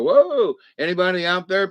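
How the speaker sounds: high, loud call into the void. Pretend. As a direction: shout it out into the dark. Mole calling out into realm.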